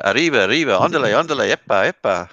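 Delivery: "arriba, arriba, ándale" as a hammed-up Mexican cartoon mouse; lightly voiced cartoon Mexican cheer; mock Mexican cartoon shout. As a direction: rattle it off like Speedy Gonzales, playful Mexican accent. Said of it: "You wanna hear some Mexican".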